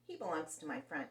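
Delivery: plain reading voice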